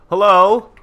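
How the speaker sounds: loud high call for attention. Real, not acted. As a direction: shout to get someone's attention. Calling out to game NPC.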